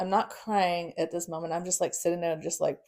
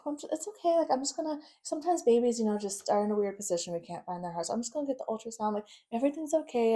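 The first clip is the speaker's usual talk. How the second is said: lightly voiced nurse, higher and hurried; reassuring nurse; 5 semitones higher, faster than usual. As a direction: imitate a nurse rushing to soothe a patient. Nurse hurriedly reassures worried mother.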